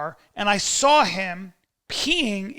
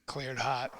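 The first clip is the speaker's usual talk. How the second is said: low, quiet military clearance; mock action hero; 6 semitones lower, 11 dB quieter than usual. Hero going into battle, low quiet mock command. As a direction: murmur it low, playing cool.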